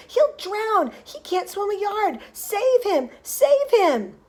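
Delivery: high, loud frantic pleading; panicked screaming. A narrator's reading. Mother begging rescue for drowning son, panicked screaming. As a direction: plead frantically at the top of your voice. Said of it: "screamed Missus Gloop".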